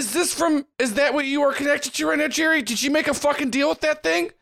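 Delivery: she's catching Jerry in a betrayal; high, suspicious fucking-deal accusation; accusing NPC voice. Acted. NPC's split-off self confronts Jerry.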